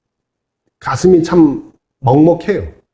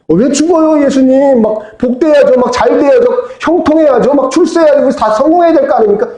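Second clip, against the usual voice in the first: higher, eager, faster protest; Peter protesting; 6 semitones higher than usual. Retold or imitated. Peter protesting to Jesus that they should prosper, higher and eager. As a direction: whine about wanting success.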